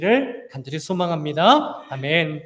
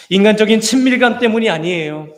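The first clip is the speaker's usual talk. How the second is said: loud, raised preaching voice; impassioned preaching; 4 semitones higher, 7 dB louder than usual. Real, not acted. Preacher telling the congregation why they are family, impassioned. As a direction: drive the point home to the congregation, loud and high.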